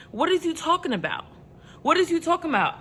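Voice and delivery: high-pitched incredulous challenge. Real, not acted. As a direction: ask it in disbelief, pitch lifted then falling. Mocking an absurd excuse.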